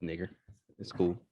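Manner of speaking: normal talking voice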